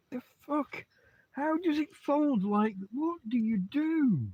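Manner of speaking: ranting at the dog. Real, not acted. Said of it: "ranting at the dog".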